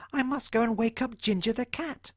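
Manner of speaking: high, small determined mouse; a tiny mouse making up his mind. Acted. John Mouse deciding to act, squeaky. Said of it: "thought John Mouse".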